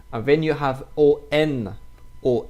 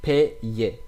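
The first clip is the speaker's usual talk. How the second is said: ordinary talk, nothing marked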